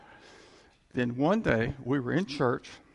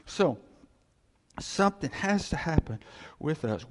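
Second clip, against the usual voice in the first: ordinary talk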